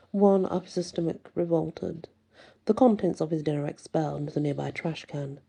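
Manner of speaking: ordinary delivery, nothing marked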